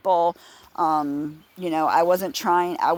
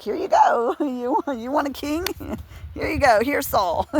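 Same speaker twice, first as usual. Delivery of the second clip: higher, giggling, lightly voiced God; mock God handing over; 8 semitones higher than usual. God handing the Israelites Saul, mock giggly and higher. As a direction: tease it out like giving in with a laugh. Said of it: "you know, like".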